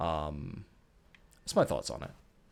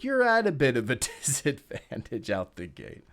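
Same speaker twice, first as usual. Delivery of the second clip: ordinary talk, nothing marked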